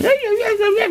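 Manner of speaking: high nonsense squeaks; piping falsetto yapping; squeaky noises. Shown as a demonstration. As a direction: mimic wordless squeaky chatter. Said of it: "don't walk around talking to each other by going".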